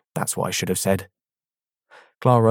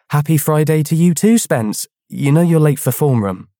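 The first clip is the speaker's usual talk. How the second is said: put-on raised, snippy girl's voice; sarcastic schoolgirl; 7 semitones higher, 6 dB louder than usual. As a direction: tart know-it-all classmate. Clara voiced in story.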